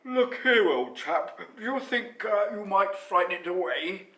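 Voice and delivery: loud animated lion; lion character. A narrator's reading. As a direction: put on a big lion voice, pressing a question. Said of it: "said the lion".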